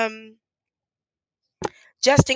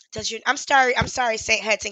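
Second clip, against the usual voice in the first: ordinary talk, nothing marked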